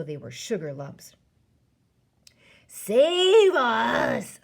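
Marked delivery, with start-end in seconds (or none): 2.7-4.4 high, loud, drawn-out cry for help; screamed. A narrator's reading. Giant thrashing about madly in a nightmare, screaming. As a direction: scream it out, stretching the vowels. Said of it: "screamed the flesh lump eater".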